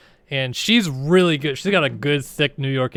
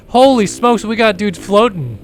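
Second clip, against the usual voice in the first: excited; 6 semitones higher, 7 dB louder than usual. Real, not acted. Sees fans floating over crowd.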